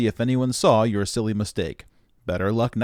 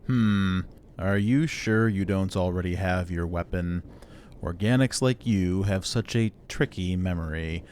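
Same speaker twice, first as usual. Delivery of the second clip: slow, level, haughty robot intellect; condescending superior machine; 4 dB quieter, slower than usual. Acted. Robot doubts the organic still has a weapon, condescending. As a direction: talk down wearily to a dim organic.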